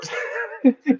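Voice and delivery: a short, high laugh; burst of laughter. Real, not acted. Laughing at the celery question, a quick high laugh. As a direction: cracks up at his own joke. Laughing at his own joke.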